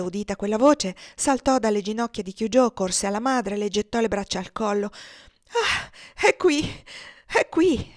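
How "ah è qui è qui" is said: high, loud cry of relief; frantic mother finding her child; exclaiming. A narrator's reading. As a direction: gasp out in overwhelmed relief. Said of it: "esclamò essa".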